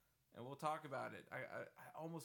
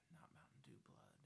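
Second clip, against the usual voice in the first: hushed aside; breathy whisper under breath; 17 dB quieter than usual. Real, not acted. Joking aside about drinks.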